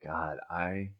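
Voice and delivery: soft, dreamy stoned voice; awed and spaced out; stoner. Acted. Acting high, whole new world.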